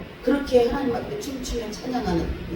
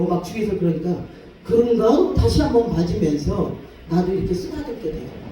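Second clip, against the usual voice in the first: usual speaking voice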